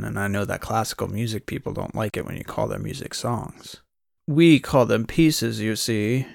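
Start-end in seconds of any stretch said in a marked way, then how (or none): 4.3-6.3 slow, louder mock posh accent; put-on accent. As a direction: imitate her pretentious fancy accent, drawn out. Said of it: "in that put-on accent".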